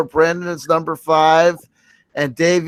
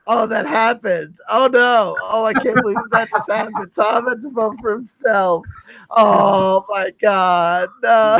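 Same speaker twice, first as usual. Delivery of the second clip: higher, hammed-up lament; mock despair; 5 semitones higher than usual. Host bemoaning Tom voting for himself, mock lament. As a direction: wail as if tragedy struck. Host mourns Tom's self-vote.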